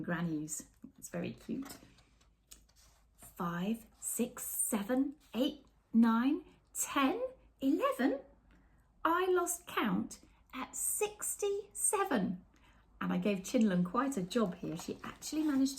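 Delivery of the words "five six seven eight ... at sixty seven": building count. A narrator's reading. Counting the story's cats for children, building excitement. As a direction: climb with every cat you count.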